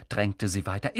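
ordinary delivery, nothing marked

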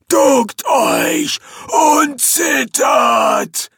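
high, very slow demon bellow; menacing demon. Acted. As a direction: command them to cower. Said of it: "fuhr der Dämon fort".

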